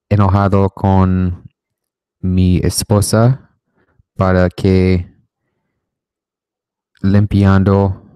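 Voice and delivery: slow, loud, word-by-word Spanish; halting Spanish. Shown as a demonstration. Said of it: "Are you fluent in Spanish?".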